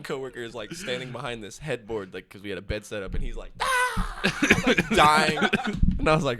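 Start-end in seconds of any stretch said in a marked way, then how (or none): 3.6-4.2 high-pitched "Ah!" through laughter; mock startled cry. Coworker reacting behind the headboard, high mock cry.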